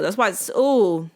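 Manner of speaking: ordinary delivery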